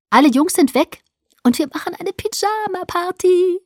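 put-on high, loud, sing-song girlish voice; trilling announcement. A narrator's reading. Alice verkündet Bella die Pyjama-Party, trällernd. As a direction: trill it out with delight. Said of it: "verkündete sie trällernd".

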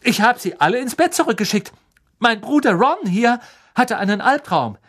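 fast, high, loud protest; indignant. A narrator's reading. Percy indignantly justifying himself to McGonagall, fast and loud. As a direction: defend yourself in outrage. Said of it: "sagte Percy empört".

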